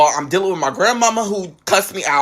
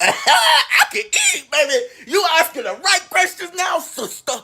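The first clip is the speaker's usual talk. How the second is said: hammed-up excitement, pitched way up and stretched; gleeful reply; 11 semitones higher than usual. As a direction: squeal it with hungry enthusiasm. Acting out delighted answer about food.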